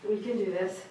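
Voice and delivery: ordinary talk, nothing marked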